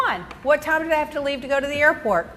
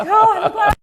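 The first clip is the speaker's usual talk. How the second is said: happy exclaim; 8 semitones higher, 6 dB louder than usual. Retold or imitated. Mother's reply, excited.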